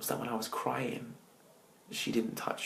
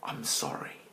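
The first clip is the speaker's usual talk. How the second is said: softer, mostly breathy broken plea; retold crying prayer; 5 dB quieter than usual. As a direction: mildly recall sobbing to God. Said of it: "And I said in my cry".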